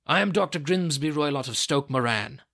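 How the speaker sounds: loud, forceful self-announcement; blustering doctor. A narrator's reading. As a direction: announce yourself loudly, an aggressive intruder. Menacing visitor announces himself.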